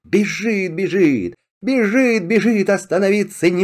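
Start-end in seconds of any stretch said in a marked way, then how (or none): none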